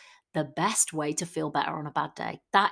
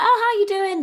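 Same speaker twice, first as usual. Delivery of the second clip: bright high mock-cheery hello, much higher and louder; cheery greeting; 14 semitones higher, 9 dB louder, faster than usual. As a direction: greet a friend with exaggerated brightness. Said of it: "you were like".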